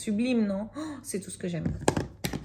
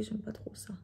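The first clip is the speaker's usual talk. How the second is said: whispering; 15 dB quieter than usual. Real, not acted. Aside while blending eyeshadow.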